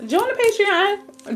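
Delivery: drawn-out high plug; high sing-song. Acted. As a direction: sing it high and slow, teasing.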